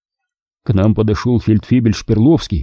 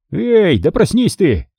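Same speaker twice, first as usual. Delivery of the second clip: urgent nudge, higher voice; waking a comrade; 8 semitones higher than usual. A narrator's reading. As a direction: rouse a sleeping friend urgently. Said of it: "сказал он".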